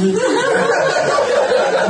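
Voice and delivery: high, loud burst of laughter; big laugh. Real, not acted. Old lecturer laughing at his own joke.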